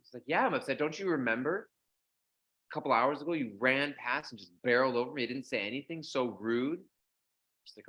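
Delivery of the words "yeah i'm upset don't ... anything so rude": louder, higher indignant complaint; mock angry sage. Offended sage scolding the young woman, indignant.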